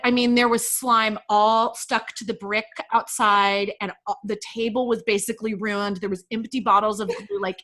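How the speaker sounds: ordinary delivery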